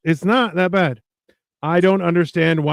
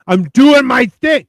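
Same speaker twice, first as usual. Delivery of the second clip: louder, higher, slow emphatic imitation; mock aggressive; 7 semitones higher, 6 dB louder, slower than usual. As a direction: imitate someone aggressively insisting on being left alone. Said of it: "he's so aggressive with it".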